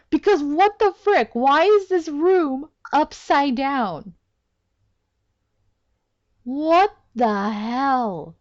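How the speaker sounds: high, loud and slowly drawn out; a real gamer losing it over a twist; freaked-out disbelief. Real, not acted. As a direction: stretch every word in real shock. Freaking out at the upside-down room.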